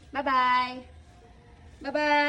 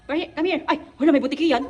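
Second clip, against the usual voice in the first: ordinary delivery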